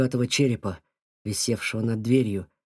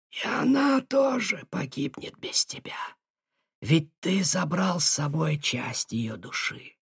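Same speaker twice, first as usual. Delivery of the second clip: slow, eerie whispering prophet; sinister old seer; 2 semitones higher, 4 dB quieter, slower than usual. A narrator's reading. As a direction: whisper the doom slowly. Nuhim warns of ancient magic.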